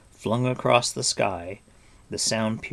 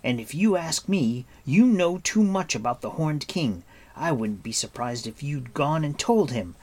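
higher, indignant accusation; angry reply; 5 semitones higher, 4 dB louder than usual. A narrator's reading. Taran suspecting Gurgi of betraying them, angry. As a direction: accuse him crossly. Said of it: "Taran replied angrily".

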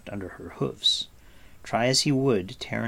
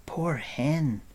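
pitying whisper; 5 semitones higher than usual. Acted. Taran pitying Hen Wen.

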